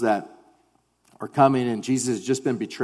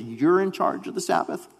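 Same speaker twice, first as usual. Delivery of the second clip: higher-pitched mock disbelief; incredulous question; 5 semitones higher than usual. Pharisees and crowd react to Jesus' claim, incredulous. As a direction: question it as if you can't believe the claim.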